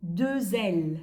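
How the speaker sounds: "Deux L" linked with a z sound; French liaison. Shown as a demonstration. Teacher answering how double L is read, "Deux L" with z liaison. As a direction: carry a z from "Deux" into "L". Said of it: "Nhớ là có việc nối âm".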